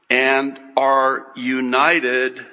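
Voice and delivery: normal talking voice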